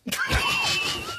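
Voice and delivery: sudden high laughing outburst; burst of laughter. Real, not acted. Bursts out laughing mid-story.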